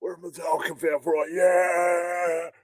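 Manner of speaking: loud, high fan cheer; rally like a roaring crowd; hyped fan shout. Retold or imitated. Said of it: "And the world responded with".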